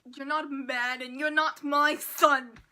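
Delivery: loud, stern dad rebuke; a hammed-up furious dad disowning his son; mock angry father. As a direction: scold like a disappointed father.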